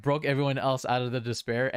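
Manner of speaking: usual speaking voice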